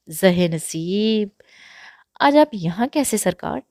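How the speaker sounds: put-on slow, loud, syrupy old madam greeting. Madam greets the visiting master while chewing paan, slow and loud. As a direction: drawl it like a sly courtesan madam.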